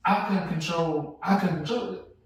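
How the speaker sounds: ordinary delivery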